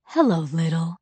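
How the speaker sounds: a hint of an exhausted dad; father home from work, worn out; tired father. A narrator's reading. Tired father greets daughter.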